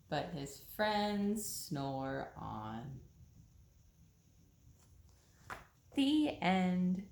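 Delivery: slow, sing-song read-aloud voice; storybook reading. A narrator's reading. Reading a bedtime story to children, slow and sing-song. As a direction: read it slowly like a bedtime picture book.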